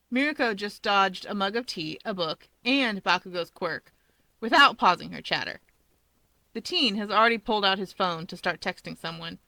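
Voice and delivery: usual speaking voice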